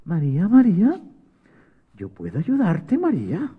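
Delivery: soft, small voice of an ant; tiny little voice. Acted. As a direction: call very softly, rising at the end. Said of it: "María escuchó una vocecita que decía".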